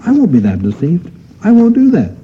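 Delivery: rising to a high indignant protest; a touch of smug protest; mock confident believer. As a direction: play the sure-of-himself listener. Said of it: "And we say".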